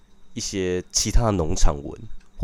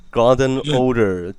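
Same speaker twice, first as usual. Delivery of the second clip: Golden Order said slowly, each syllable clear; slow clear repeat; 6 semitones higher, much slower than usual. Shown as a demonstration. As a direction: spell out Golden Order slowly for a listener who misheard. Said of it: "什么贝德系列？". (慢速清楚地重复 Golden Order)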